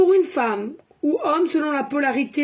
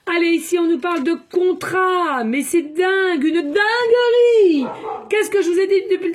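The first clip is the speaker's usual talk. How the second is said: excited shouting; 4 semitones higher, 5 dB louder than usual. Real, not acted. Reader amazed by the contract card, excited shouting. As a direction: exclaim it, stretching the final word into a shout. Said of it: "j'ai peut-être créé trop fort".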